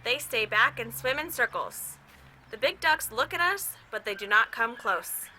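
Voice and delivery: put-on gentle read-aloud to a child; calm storybook narration; story reading. A narrator's reading. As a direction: read it like a picture book at bedtime. Reads a picture book aloud.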